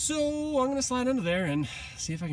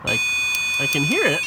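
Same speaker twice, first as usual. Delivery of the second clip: shouting over noise; 9 dB louder, faster than usual. Real, not acted. Shouting over loud engine noise.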